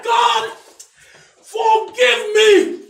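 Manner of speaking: loud, high, drawn-out cry for forgiveness; pleading prayer. Acted. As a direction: beg God at the top of your voice. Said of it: "And he said".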